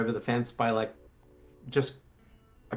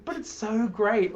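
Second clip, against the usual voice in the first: high voice, imitating a lovestruck guy; mock blissful lover; 9 semitones higher, slower than usual. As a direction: pitch it up, dreamy excuse.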